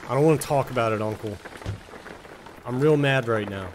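usual speaking voice

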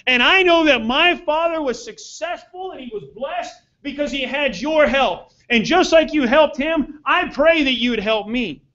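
earnest spoken prayer to God; a pastor praying before the sermon. Real, not acted. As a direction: pray it, humble and sincere. Opening prayer.

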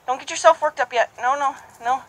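high, loud rapid no's to check the horse; high insistent no no no; urgent correction. Shown as a demonstration. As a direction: shows what he'll call out to stop the trot. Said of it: "And say".

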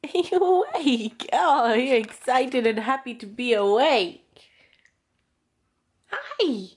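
high, slow sing-song cooing. Real, not acted. Mother cooing at waking baby.

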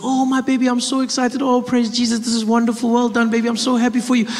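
high-pitched gushing mother; excited mom. Retold or imitated. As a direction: imitate a thrilled mom showering praise. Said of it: "And she's like".

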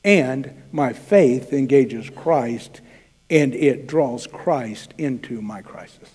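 "my faith engages Christ" stretched out slowly; slow, reflective sermon close; slow, soft emphasis. Shown as a demonstration. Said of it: "here's the second point".

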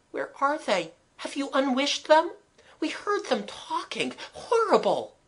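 put-on high-pitched, anxious child's questions; frightened. A narrator's reading. Scared children asking where the living dummies went, frightened. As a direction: ask fearfully in a high voice.

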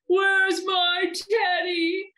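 high, drawn-out loud wail; big whining bear. A narrator's reading. Great big bear hunts for his teddy, whining. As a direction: whine it slowly, stretching every word. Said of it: "A great big voice whined".

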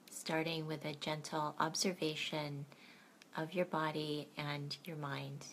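gentle bedtime meditation guide; guided meditation. Acted.

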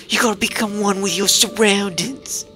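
mock Australian wildlife host, full of bravado; Crocodile Hunter impression. Player voicing the mask seller in a hammy Australian accent. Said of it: "now we gotta do Crocodile Hunter".

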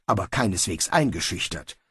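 plain reading voice

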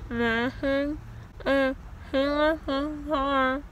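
speaking after tooth extraction; talking with gauze in mouth. Real, not acted. Seen 2: Gauze strips hang from bloody mouth throughout; R frames show none.

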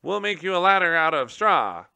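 high, loud squeaky call; a gerbil calling down to Tum Tum in a squeak. Acted. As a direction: squeak it down from the cage. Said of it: "one of them squeaked".

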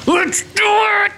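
high, drawn-out hype shout; excited shout. Real, not acted. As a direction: pump it up like a big kickoff. Excited fishing vlog kickoff.

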